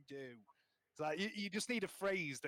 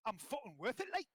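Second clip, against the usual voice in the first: high-pitched Geordie impression; hammed-up Geordie catchphrase; Geordie accent; 6 semitones higher, 3 dB louder than usual. Shown as a demonstration. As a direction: put on a lilting, higher Geordie voice. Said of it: "my phrase for Geordie".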